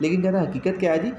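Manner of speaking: usual speaking voice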